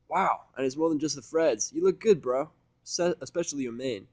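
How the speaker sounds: a cheerful mare ribbing her friend; Vinyl, friendly DJ mare. Acted. Vinyl complimenting Seth.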